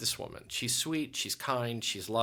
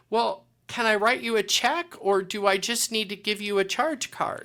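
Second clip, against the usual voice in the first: high, louder cheerful offer; happily ready to pay; eager customer; 9 semitones higher, 6 dB louder than usual. Retold or imitated. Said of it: "She goes".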